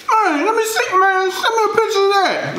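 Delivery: high falsetto mock excitement; hammed-up squeaky eagerness; excited caller. Acted caller asks for picture.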